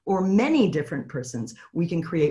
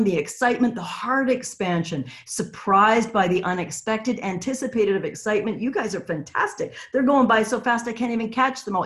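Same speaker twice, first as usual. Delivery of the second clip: ordinary talk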